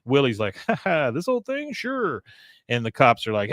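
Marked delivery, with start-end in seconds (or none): 0.7-2.2 high-pitched, put-on bashful chuckle; mock modesty. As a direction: play coy for admiring fans. Willie plays modest to fans.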